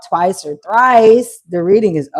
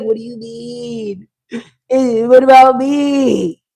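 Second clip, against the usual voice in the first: drawn-out hammed-up whine, rising in volume; mock wail; 3 semitones higher, 4 dB louder, slower than usual. Left-behind hater protesting to the reader's client, mock wail. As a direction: wail it in mock self-pity, stretching each word. Acted jealous person protesting, wailed.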